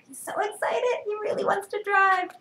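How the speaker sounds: high, animated picture-book voice; reading to children. Acted. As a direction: talk up to the little ones. Reader commenting in high voice.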